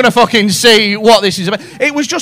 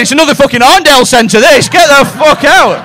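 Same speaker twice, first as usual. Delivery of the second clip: loud, higher ranting; acted angry outburst; 5 semitones higher, 7 dB louder than usual. Comic acts a shopper in Liverpool One, loud angry rant.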